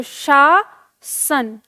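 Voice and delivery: शा सन slowly, split into two parts; शा सन broken up to contrast sh and s; syllable by syllable. Shown as a demonstration. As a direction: draw out palatal शा, pause, then dental सन. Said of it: "इस तरह से हम जब इसका उच्चारण".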